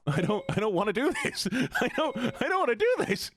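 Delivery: high, laughing whine of dread. Real, not acted. Streamer dreading the climb, laughing nervously. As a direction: laugh through the panic.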